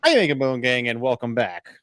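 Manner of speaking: ordinary talk, nothing marked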